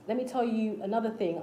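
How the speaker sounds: ordinary delivery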